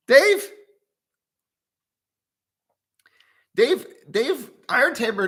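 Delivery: high, loud call across the room. Real, not acted. Streamer calling to Dave in the room, loud call. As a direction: call out to get Dave's attention.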